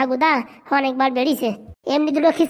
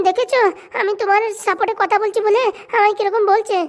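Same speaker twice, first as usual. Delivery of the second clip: higher, whining wife protesting; whiny wife; 6 semitones higher than usual. Acted. Wife complaining to her husband about Phuto, high whine. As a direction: play the wife, aggrieved and shrill-pitched high.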